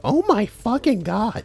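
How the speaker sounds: imagined appalled reaction in a high, drawn-out voice; mock someone's horror, slow and high-pitched; Hannah. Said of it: "Hannah's gonna be like".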